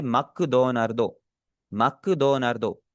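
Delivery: slow, syllable by syllable मकडोनार्दो; Japanese pronunciation. Shown as a demonstration. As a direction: spell out मकडोनार्दो slowly for students. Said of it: "उसे क्या बोलेंगे?". (धीरे-धीरे जापानी उच्चारण में मकडोनार्दो)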